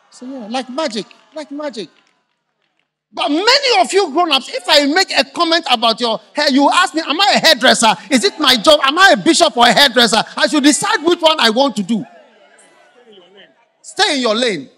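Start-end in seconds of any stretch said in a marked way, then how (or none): 3.1-14.7 loud, high preaching rant; full-force preaching. Acted. Preacher scolding the grown-ups, loud and rising. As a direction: preach it hard at the congregation.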